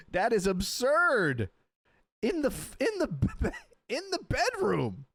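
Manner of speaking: high, drawn-out incredulous outburst with laughs; flabbergasted. Real, not acted. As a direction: stammer in high-pitched disbelief. Flabbergasted by the tanning bed.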